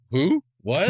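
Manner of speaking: hammed-up bewildered guard; surprised. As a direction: sputter in mock confusion. Character startled by his language.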